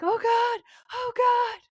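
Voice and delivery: high-pitched pretend wail; mock panic. Corbett freaking out at the police, mock panic. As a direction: squeal it like someone losing it, hammed-up.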